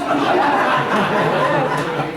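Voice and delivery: a real, loud burst of laughter at his own joke. Real, not acted.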